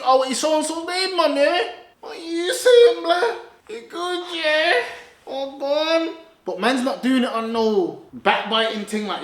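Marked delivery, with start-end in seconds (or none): 0.0-6.2 high, loud teasing taunt; mock taunt. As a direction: goad him like a cocky rival. Acting out 50's taunting call.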